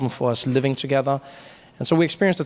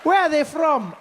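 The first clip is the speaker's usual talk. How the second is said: curious viewer; 12 semitones higher, slower than usual. Retold or imitated. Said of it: "we're like".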